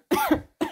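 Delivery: quick dry cough. Real, not acted. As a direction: cough mid-sentence. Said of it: "je tousse encore".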